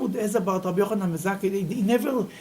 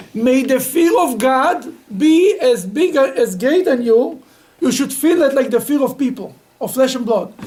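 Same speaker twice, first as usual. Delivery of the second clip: solemn blessing; 5 semitones higher, 7 dB louder than usual. Retold or imitated. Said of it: "They said like this".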